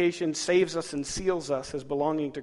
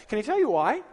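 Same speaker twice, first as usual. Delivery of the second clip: usual speaking voice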